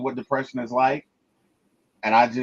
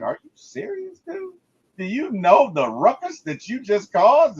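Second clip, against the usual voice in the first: much higher, disbelieving; incredulous teammates; 7 semitones higher than usual. Retold or imitated. Teammates reacting to Robert's amnesia, incredulous. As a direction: squeak out the disbelief. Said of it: "they're looking at him like".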